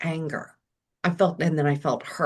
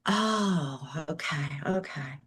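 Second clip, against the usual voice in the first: choked-up, much softer Oh okay; teary; 2 semitones lower, 6 dB quieter, slower than usual. Real, not acted. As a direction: let the tears catch in the throat on okay. Tearful realization in retold moment.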